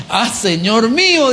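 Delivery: high, drawn-out mock cry; grito de angustia imitado. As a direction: wail it like a man who lost something borrowed. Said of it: "Y gritó y dijo". (lamento agudo y alargado)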